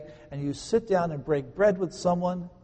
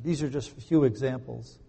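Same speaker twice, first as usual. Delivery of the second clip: ordinary talk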